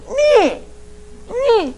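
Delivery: put-on very high baby "nee"; baby whine. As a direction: whine like a toddler. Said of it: "the baby goes".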